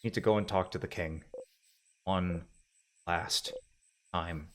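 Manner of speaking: very soft, low, trailing off; fading resolve. Acted. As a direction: let it die away word by word.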